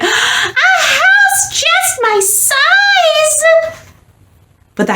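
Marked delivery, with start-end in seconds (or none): 0.0-3.4 high, slow squeal of joy with a laugh; delighted squeal. A narrator's reading. Mouse spotting the tiny house, squealing with joy.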